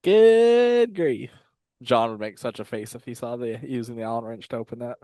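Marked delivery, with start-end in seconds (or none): 0.0-1.3 loud, high good grief; exasperated exclaim. Real, not acted. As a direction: throw up your hands, good grief. Exasperated at using the wrench.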